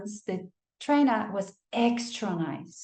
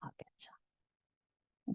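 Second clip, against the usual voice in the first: a low whispered threat; menacing whisper; 19 dB quieter, much slower than usual. Retold or imitated. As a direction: breathe the threat, barely voiced.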